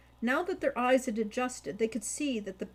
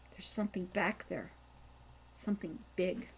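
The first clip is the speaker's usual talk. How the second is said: softer, lower hushed warning; 3 semitones lower, 6 dB quieter than usual. Acted. Hawk warning his brother of something in the dark, hushed. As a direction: warn him without a sound.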